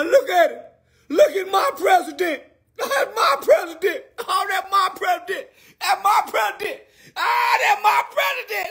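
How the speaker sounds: high, loud, sobbing with joy; overjoyed yelling and crying at once; ecstatic shouting. Real, not acted. Ecstatic crying shouts over Trump win.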